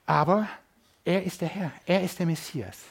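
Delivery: ordinary delivery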